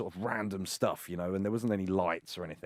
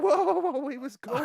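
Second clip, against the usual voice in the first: startled protest; 3 dB louder than usual. Retold or imitated.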